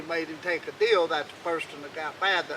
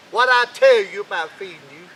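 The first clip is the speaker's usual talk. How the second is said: much louder, scolding; angry old king; 3 semitones higher, 12 dB louder than usual. Acted. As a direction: shout him down for asking again. King scolds hungry Jack.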